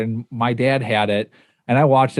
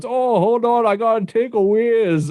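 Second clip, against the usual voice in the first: much higher, hammed-up Canadian accent; a goofy, bad Canadian accent for a cartoonish movie character; poor Canadian accent; 8 semitones higher than usual. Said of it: "that was a poor Canadian accent".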